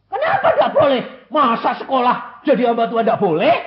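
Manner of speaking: high, loud, with a laugh, why not; incredulous protest. Retold or imitated. Arguing with God, laughs. (protes heran sambil tertawa)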